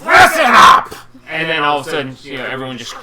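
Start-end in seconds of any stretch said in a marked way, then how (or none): 0.0-0.9 high, very loud order; commanding shout. Acted. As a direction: shout a rowdy crowd to attention. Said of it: "you hear her voice".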